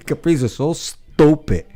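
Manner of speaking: slow mocking "stupid"; drawn-out stupid. Shown as a demonstration. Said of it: "some people say stupid".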